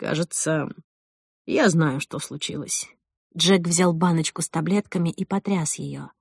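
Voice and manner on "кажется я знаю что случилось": low, soft, measured remark; hushed, lower-pitched certainty; quiet composure. Acted. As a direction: say it calmly, voice dropped. Jack calmly undermines her after outburst.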